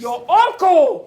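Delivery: slow, loud stress on each word; loud rebuke. Acted. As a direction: drag it out in loud reproach. Mother scolds daughter.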